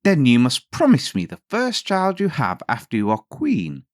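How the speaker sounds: a sly imp striking a hard bargain; little man. A narrator's reading. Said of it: "said the little man".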